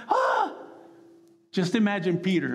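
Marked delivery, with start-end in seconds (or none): 0.1-0.5 high-pitched mock panicked cry; short "Ah!" like a headless chicken; panicked yelp. Mimicking professionals running around clueless, mock panic. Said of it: "chickens with their heads cut off going".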